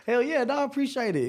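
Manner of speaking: excited. Retold or imitated. Thrilled reply to Dan's praise.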